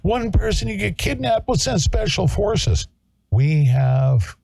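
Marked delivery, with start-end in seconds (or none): 0.0-2.9 high sing-song mockery of the official story; high mocking voice ridiculing a claimed rescue. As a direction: parrot the official line in a much higher, prissy voice, slowing at the end. Mocking the official hoax line.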